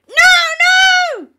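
high, drawn-out, full-volume shriek; demon's shriek. A narrator's reading. Demon recoiling as the exorcist thanks the Pope, shrieking. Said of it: "the demon shrieked".

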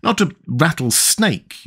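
plain reading voice

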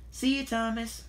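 high-pitched farewell call. A narrator's reading. Rusty sees Thomas off, calling out. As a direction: call goodbye across the tracks, high. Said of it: "called Rusty".

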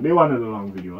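usual speaking voice

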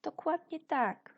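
high, bright burst of praise; loud, delighted approval; excited praise. Real, not acted. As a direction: cheer the pupils' right answer. Teacher praising a correct answer.

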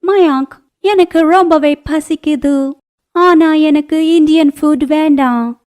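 put-on very high, hungry young-wife voice; high put-on foreigner's voice; foreign daughter-in-law. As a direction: whine to your husband, pitched right up. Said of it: "ஆனா மயாங்க் கிட்ட இப்படி சொன்னா".

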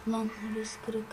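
whispered aside; whispering to himself about the broken spot. Real, not acted. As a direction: breathe it under your breath. Muttering to self.

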